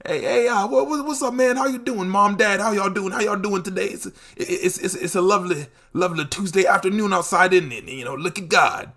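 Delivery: hammed-up jolly old man; chirpy elder chatting up the parents; friendly old stranger. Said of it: "walk up to me and hit me with that".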